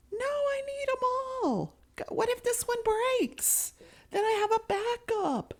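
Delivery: put-on very high quavering old woman; whiny old lady. Grandma defending her twenty-two spares, very high whine. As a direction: plead high for the backups.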